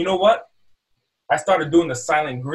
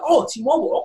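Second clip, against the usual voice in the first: high-pitched show of delighted recognition; keen interest; 10 semitones higher than usual. Acted. Salesman reacting to a customer's carrier, keenly interested. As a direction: pitch jumps up, playing impressed.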